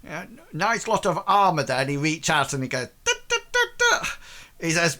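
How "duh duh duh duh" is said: knocking sounds. Acted. Captain knocks on armour.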